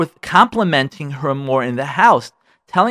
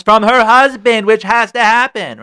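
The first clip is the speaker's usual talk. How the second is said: worked up; 7 semitones higher, 7 dB louder, slower than usual. Real, not acted. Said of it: "so worked up today".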